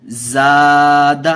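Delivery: زاد drawn out slowly, long first vowel; teacher models زاد for pupils to copy; slow model word. Shown as a demonstration. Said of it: "دیکھیے کیسے پڑھیں گے".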